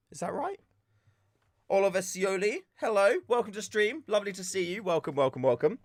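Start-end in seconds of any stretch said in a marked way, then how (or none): 0.1-0.6 a small whispered check; whispered aside. Real, not acted. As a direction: whisper it to yourself, unsure. Unsure of pronunciation.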